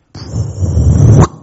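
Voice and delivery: long airy whoosh bursting out loud; imitated impact. Preacher imitating two planes hitting the towers, drawn-out whoosh. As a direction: stretch 푹 into a breathy blast.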